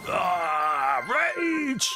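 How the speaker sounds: sudden high yell of disgust; rage shout. Real, not acted. Sour bean reaction, shouting.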